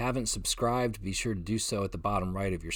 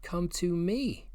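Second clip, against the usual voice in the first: "me" slow and higher; stress on me; 8 semitones higher, slower than usual. Shown as a demonstration. Preacher contrasting "me" with "my teaching", slow stress. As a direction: land on "me". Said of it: "He didn't say, "Come to my teaching".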